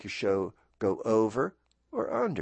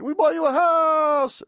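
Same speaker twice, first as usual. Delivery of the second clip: much higher, louder, slow and showy announcement; Oprah; 7 dB louder than usual. Retold or imitated. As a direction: present the huge gift like a TV host. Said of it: "Oprah was like".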